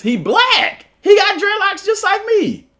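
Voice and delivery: high mock-indignant protest; amused protest. Real, not acted. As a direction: pitch shooting up in playful outrage. Joking about the racist claim.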